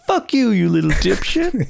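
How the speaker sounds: high, put-on insult; mock gruff stranger. Stranger cursing the kid in the retold film scene. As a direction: snap back like an angry stranger. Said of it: "And he goes".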